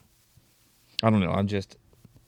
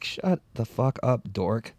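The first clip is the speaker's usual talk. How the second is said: slow, softer put-down; dismissive retort; 5 dB quieter, much slower than usual. Retold or imitated. Fed-up listener to the rapper, slow dismissal. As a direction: space the words out in mock exasperation. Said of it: "it's just like".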